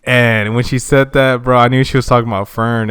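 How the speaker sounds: ordinary talk, nothing marked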